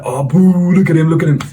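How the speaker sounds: mock booing. Classmate heckling him in the canteen, mock booing. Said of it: "started booing me".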